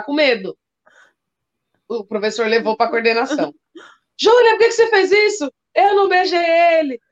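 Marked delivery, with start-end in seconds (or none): 4.2-7.0 playing both teacher and the girl, pitched up; teacher and child voices. Teacher questioning crying child. (professora e aluna, voz aguda)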